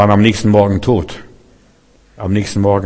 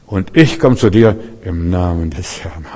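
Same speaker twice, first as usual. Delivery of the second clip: ruhige Gewissheit; 5 semitones lower, 4 dB quieter than usual. Acted. Davids ruhige Antwort an Goliath.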